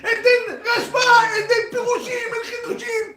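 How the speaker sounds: high, loud, fast-starting proclamation; loud mock lecture. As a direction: act out a man holding forth loudly. Acting husband lecturing at table.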